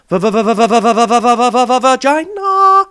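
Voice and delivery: rapid-fire stuttered va-va-va into a loud laughing vagina; machine-gun the first syllable then blurt the word; stuttered build-up. Pretend. Vlogger teasing viewers, rapid stutter into a loud laughing blurt.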